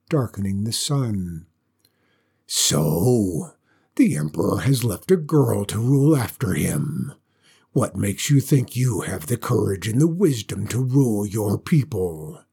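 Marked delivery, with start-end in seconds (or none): 2.5-12.4 low, scornful dragon voice; mocking dragon. Dragon scorning the girl who would rule, low and mocking. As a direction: sneer down at the little girl. Dragon mocks Sai Ling.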